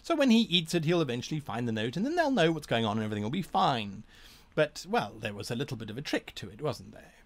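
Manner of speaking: rapid, eager chatter; fast gnome voice. Shown as a demonstration. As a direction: rattle it off like an excited gnome. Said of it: "So that's a way of playing the gnome".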